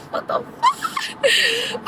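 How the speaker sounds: high, slow, laughing what the fuck; giggling in disbelief at it all. Real, not acted. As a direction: laugh it out helplessly. Laughing at own despair.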